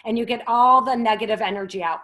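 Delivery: loud, fast, forceful push of breath; loud lion's breath. Shown as a demonstration. Yoga teacher showing kids lion's breath, loud forceful exhale. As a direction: blast the bad energy out, loud and fast. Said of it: "like that".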